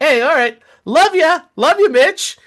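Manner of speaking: high, loud bright farewell; gushing a hammed-up love you; cheery goodbye. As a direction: sing out the goodbye. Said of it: "we'll be like".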